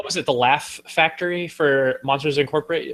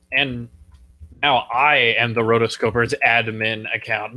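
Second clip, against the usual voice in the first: normal talking voice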